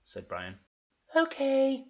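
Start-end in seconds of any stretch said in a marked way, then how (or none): 1.1-1.8 high falsetto character; loud, high-pitched reply; Florence. Acted. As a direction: put on a girly falsetto. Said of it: "said Florence".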